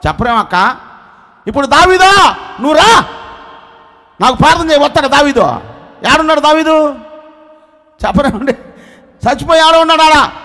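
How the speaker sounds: loud, high, pressing sermon delivery; full-force preaching. Acted. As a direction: preach it hard at the congregation. Preacher exhorting congregation loudly. (గట్టిగా ప్రసంగం)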